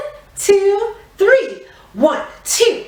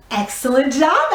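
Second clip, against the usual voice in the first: normal talking voice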